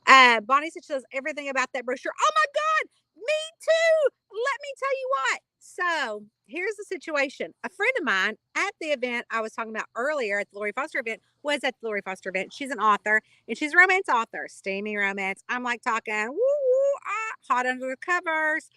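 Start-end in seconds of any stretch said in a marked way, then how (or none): 2.2-5.4 excited squeal. Real, not acted. Reacting to viewer Bonnie's comment. 16.3-18.7 teasing woo. Pretend. Teasing about steamy romance books.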